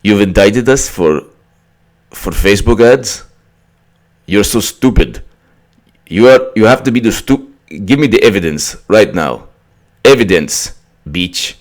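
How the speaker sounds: indignant fake Russian; mock outraged Russians in a terrible accent; hammed-up bad Russian accent, demanding and scornful; horrible Russian accent. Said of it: "horrible accents".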